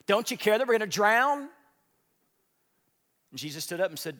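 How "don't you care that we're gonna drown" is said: loud, higher cry of panic over the storm; panicked plea. Acted. Disciples waking Jesus in the storm, loud panicked plea. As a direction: yell it like the boat is sinking.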